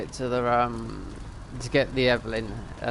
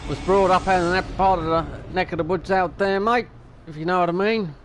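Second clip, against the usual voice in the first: mock Australian accent, higher and louder; 5 semitones higher, 4 dB louder than usual. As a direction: slip into an Aussie accent with mate. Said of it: "Adelaide, 'cause they".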